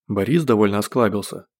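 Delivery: ordinary delivery, nothing marked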